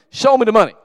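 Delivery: very loud, high, hollered order; demanding full-force yell. Acted. Rod makes Jerry say it right, full-force yell. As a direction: drill him by hollering it at full blast.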